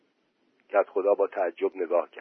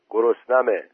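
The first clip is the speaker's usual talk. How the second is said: ordinary delivery, nothing marked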